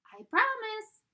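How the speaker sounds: high, loud tiny promise; a sly little pigtail swearing. Acted.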